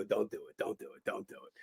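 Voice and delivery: very soft, repeated plea; acted-out hushed begging off; fading plea. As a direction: say it under the voice, fading away. Retold plea, repeated and trailing away.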